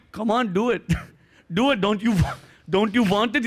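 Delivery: put-on old Indian spotter cheering, Indian accent. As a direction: egg him on in an Indian accent. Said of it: "that accent is fucking dangerous".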